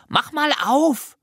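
put-on very high, drawn-out little-girl urging; child calling out. A narrator's reading. Jana urging the narrator to open the cookie tin, high call. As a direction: call out high and insistent, stretching the end. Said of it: "rief Jana".

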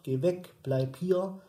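ordinary talk

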